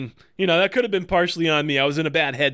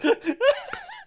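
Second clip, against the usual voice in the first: high burst of laughter. Real, not acted. Host laughs at friend's story.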